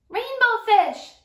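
high loud call after him. Acted. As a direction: call out to make him wait. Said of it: "he called".

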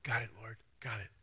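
soft, breathy whisper of assent; hushed reply. Pretend. Preacher whispering his pretend reply to the Lord. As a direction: whisper it, like a private yes to God. Answering God's call, hushed.

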